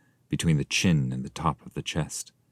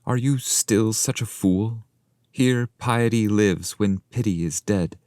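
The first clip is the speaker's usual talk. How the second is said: higher-pitched scolding guide; the escort scolding the weeping pilgrim, voice pitched higher; stern rebuke; 6 semitones higher than usual. Acted.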